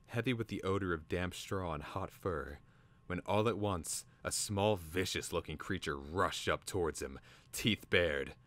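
plain reading voice

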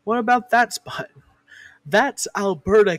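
ordinary talk

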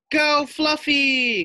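root for him from the crowd; cheering. Acted. Boy in the crowd cheers the pig, loud cheer. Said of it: "called Wade".

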